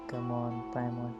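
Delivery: ordinary talk, nothing marked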